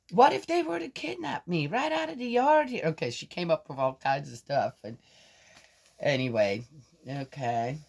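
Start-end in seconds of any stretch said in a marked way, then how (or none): 0.1-2.8 put-on much higher worried child; imagine danger like an anxious kid; granddaughter's voice. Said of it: "And I'm going".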